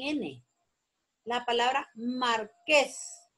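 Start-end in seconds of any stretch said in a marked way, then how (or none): 1.9-3.3 "marqués" drawn out slowly, mar-QUÉS; stress on QUÉS. Shown as a demonstration. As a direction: hold both syllables of "marqués", leaning on QUÉS. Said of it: "la sílaba tónica es ques".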